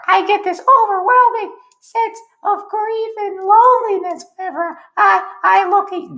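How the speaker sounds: very high, squeaky put-on voice; Josh. Josh confessing his grief to Rat Girl Genius, in a very high silly voice. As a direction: pitch it absurdly high all the way through. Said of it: "This is what Josh sounds like".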